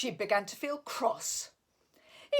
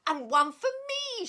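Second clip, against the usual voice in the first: high, louder happy squeal; 5 dB louder than usual. A narrator's reading. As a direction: squeal with delight. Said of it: "she squealed".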